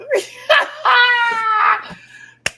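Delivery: loud high-pitched cackle; real scream of laughter; loud squealing fit of laughter; shrieking laugh. Real, not acted. Laughs at the Lydia line.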